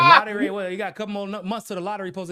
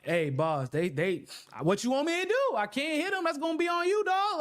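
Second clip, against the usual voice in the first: mock excuse to boss; 10 semitones higher than usual. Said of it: "I be sitting up there".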